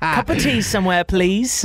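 mock-British tea order; playful attempt at a British accent; put-on British accent. Shown as a demonstration. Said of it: "Give us your best British accent".

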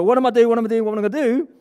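loud, high, hurried panicked babble; mock panic. Coach imitating the question with bad body language, loud high mock panic. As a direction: fire the questions out in an exaggerated fluster. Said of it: "bad body language".

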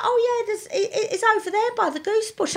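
high, unhurried mock-calm voice; imitating a calm bloke. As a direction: play the unbothered stranger, light and breezy. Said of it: "And he's like".

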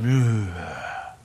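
low, scornful ugh, sinner; disgusted crowd. Retold or imitated. Crowd judging Zacchaeus, low scornful sneer. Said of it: "everybody else went".

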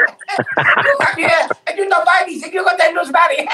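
high mock Chinese accent, shouting through laughter; Chinese shopkeeper accent. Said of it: "I'm just talking like him".

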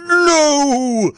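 high, loud, drawn-out cry of horror; hammed-up high wail; scream. A narrator's reading. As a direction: scream it as the horrified prince. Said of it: "The prince screamed".

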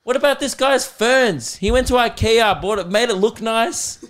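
high, loud comic protest; mock outrage. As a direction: plead indignantly for the little guy. Acted outrage for movie victim.

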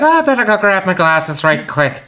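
quick aside in an Irish lilt; Irish accent. Retold or imitated. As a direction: put on a light Irish accent. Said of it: "I don't know why she's Irish".

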